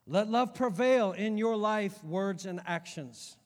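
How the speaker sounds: loud, slow, declaimed verse; forceful scripture reading. Real, not acted. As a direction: proclaim each phrase to the congregation. Reads scripture forcefully to congregation.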